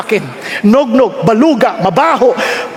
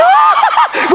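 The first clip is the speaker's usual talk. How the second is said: high mock fangirl squeal; very high imitated giggly shriek; swooning women's squeal. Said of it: "Yung mga babae".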